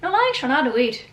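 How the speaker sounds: amateur go at an Irish accent; attempted Irish accent. Shown as a demonstration. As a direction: try it with an Irish lilt. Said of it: "I'll try and say it in an Irish accent".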